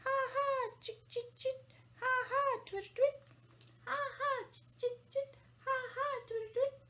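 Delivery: high, rhythmic bird chatter; imitating a twittering swallow; swallow call. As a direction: chirp it in bursts like a swallow. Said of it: "Swallow slides from under a shingle".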